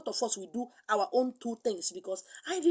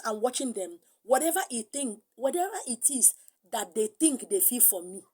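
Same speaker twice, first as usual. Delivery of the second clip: normal talking voice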